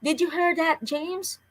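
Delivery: high, eager question; boy telling his brother he heard her; excited. A narrator's reading. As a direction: burst out with the news. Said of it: "Mark said excitedly".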